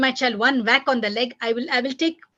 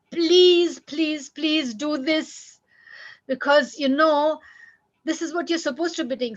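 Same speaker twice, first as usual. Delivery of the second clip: pleading parent; 5 semitones higher than usual. Shown as a demonstration. Parent begging a child to behave, pleading. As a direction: beg the child, stretching each please.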